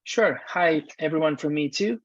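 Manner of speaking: usual speaking voice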